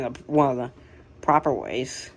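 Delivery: normal talking voice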